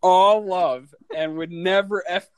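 giggling high through the words; laughing. Real, not acted. Recalling tossing paper at Flair, laughing. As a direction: laugh while you tell it.

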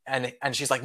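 ordinary talk, nothing marked

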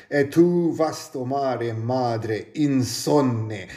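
measured, lyrical reading of Italian prose; Italian poetry recital; poetic reading. Acted. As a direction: read it like a solemn poem. Reads Gibran aloud in Italian.

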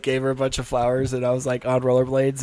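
usual speaking voice

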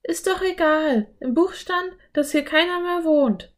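put-on girl waving away her brother's worry; insistent Anne. A narrator's reading. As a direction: brush it off and insist. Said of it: "beharrte Anne".

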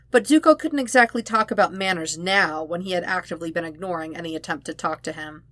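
plain reading voice

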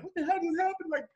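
high-pitched mock cry to God; distraught questioning. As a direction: act out pleading with God in disbelief. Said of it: "I'm questioning everything".

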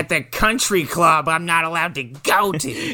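high, slow bitter whine. Retold or imitated. Wife complaining about the country club, slow high whine. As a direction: drag it out resentfully. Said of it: "whatever the fuck she's talking".

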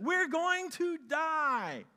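high, loud and slow wail of fear; panicked cry. Acted. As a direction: stretch it out in terror. Disciples panic in storm.

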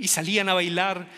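ordinary delivery

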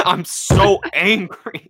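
slow, drawn-out mock-angry beast voice. Co-host retelling the film as Beast, mock angry. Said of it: "do the rest like Beast".

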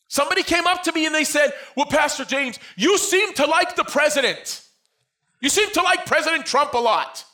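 loud, high-pitched preacher's cry; preaching at full force. Acted. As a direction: preach it out to the whole church.

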